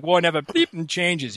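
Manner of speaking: put-on Boston accent, slowed, with "bleeping" as a jokey stand-in; "Wah" said the Boston way, "bleeping" tossed in playfully; mock Boston accent. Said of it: "I want the accent so bad".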